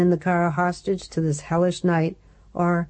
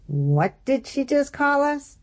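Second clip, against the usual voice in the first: indignant; 10 semitones higher than usual. Acted. Lily outraged at the insult.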